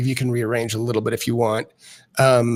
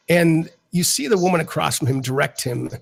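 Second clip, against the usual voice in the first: usual speaking voice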